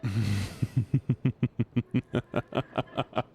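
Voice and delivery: long staccato giggle; string out a rapid chuckle; long laugh. Real, not acted. Laughs at chat.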